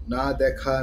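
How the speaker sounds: plain reading voice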